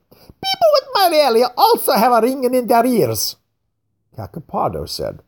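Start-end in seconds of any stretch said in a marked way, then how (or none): 0.1-3.4 mocking fat man. A narrator's reading. Craxy retorts mockingly.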